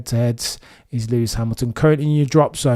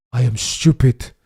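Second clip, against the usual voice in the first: imitated racing driver's accent, a bit louder; Charles Leclerc accent; 5 dB louder than usual. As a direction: put on the driver's accent, emphatically. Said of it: "in the Charles Leclerc accent".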